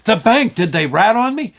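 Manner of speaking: loud, high burst of mock alarm; alarmed question. Nervous customer asks the clerk if they told on him, mock alarmed.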